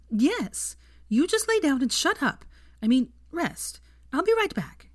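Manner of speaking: flustered Hermione ordering him to rest; bossy young witch. A narrator's reading. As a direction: snap orders, then catch yourself. Narrator voices Hermione bossing.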